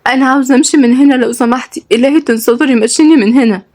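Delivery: high, pleading distress; tearful. Acted. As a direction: beg to leave on the edge of tears. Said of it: "وعيونها مليانة بالدموع".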